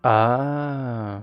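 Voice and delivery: ordinary talk, nothing marked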